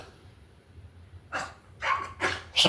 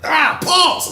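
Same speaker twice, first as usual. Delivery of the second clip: mock yelp. Food drips on chin.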